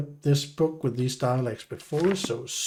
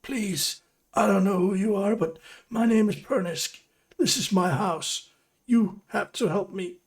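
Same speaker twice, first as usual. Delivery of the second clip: high, urgent plea; frightened prisoner; 6 semitones higher than usual. Acted. Prisoner Pernisk pleading with his rescuer, high and urgent. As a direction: beg for help, voice pitched up.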